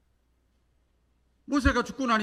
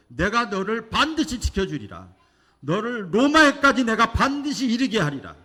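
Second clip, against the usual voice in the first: higher, louder divine promise; proclaiming promise; 5 semitones higher, 6 dB louder than usual. Retold or imitated. God promising Paul Rome, proclaimed loud. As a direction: declare it with full certainty. God's promise voiced by preacher.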